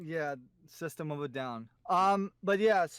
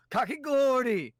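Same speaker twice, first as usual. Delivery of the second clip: excited call; 9 semitones higher, 7 dB louder than usual. Real, not acted. Excited call of character name.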